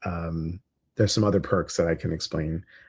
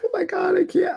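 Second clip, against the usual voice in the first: squeaky high mock panic. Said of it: "She's like".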